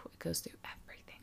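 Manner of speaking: hushed gossip whisper. Real, not acted. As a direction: lean in and whisper it.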